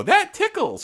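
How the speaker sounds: high squeaky teddy bear voice; squeaky toy voice. Pretend. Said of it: "it'll go".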